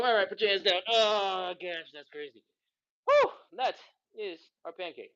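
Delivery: winded after the stretch; out of breath. Real, not acted. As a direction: puff out the words, out of breath. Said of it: "I'm out of breath".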